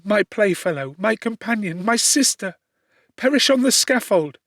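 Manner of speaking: a cousin swearing to save her friend; impassioned young woman. A narrator's reading. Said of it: "Elizabeth answers Justine".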